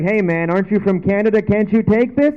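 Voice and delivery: put-on teasing girlfriend. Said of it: "my girlfriend teases me".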